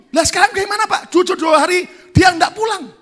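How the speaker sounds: high, loud, anxious outcry; worried shout. Retold or imitated. Church member worried about the old man, high and shouting. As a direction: blurt it out in alarm.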